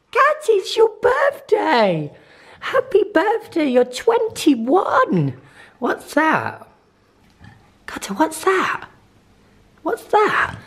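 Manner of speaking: high sing-song voice for the dog. Real, not acted. Baby talk to the dog.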